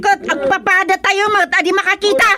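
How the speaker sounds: put-on very high, loud old woman protesting; shrill old woman crying out that she is being blamed; agitated old woman. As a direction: cry out like an outraged old woman. Old women quarrel about blindness.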